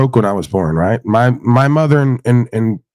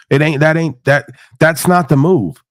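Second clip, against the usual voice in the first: ordinary talk, nothing marked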